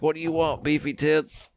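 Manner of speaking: high-pitched mocking heckle. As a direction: put on a high voice to imitate a sneering wrestler. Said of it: "and M J F was like".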